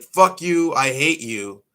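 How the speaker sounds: imitating the girlfriend's outburst; mock girlfriend. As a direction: spit it at him like an angry teen girl.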